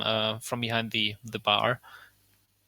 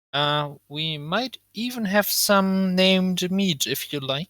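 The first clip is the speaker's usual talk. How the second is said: slow, higher offer from a tavern keeper; tiefling host; 6 semitones higher, 3 dB louder, slower than usual. Acted. Tiefling offers the guests named meat, slow and coaxing. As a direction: draw out the offer, easing off at the end.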